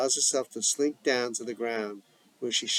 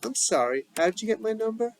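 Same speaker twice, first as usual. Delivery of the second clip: wary, pitched-up questioning; suspicious; 12 semitones higher, 4 dB louder, faster than usual. Acted. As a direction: sound guarded and suspicious. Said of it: "Idina, suspicious".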